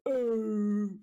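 high grunt like a camel; camel impression. Shown as a demonstration. Caster doing his camel impression for chat, a high grunt. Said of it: "my best camo impression".

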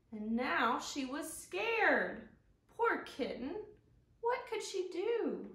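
expressive storybook reading, lifting up on the exciting parts; reading to children. Acted. Teacher reads a picture book aloud to the kids. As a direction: read it to the little ones with wide eyes.

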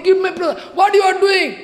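high, loud acted protest; frantic outcry. Husband and wife over the uchishta plate, frantic outcry. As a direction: shout it in urgent alarm.

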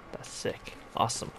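usual speaking voice

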